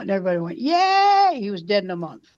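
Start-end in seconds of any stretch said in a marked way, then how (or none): 0.6-1.4 high mock cheer of a crowd; a hammed-up crowd cheering. Crowd cheering the quitter, mock cheer.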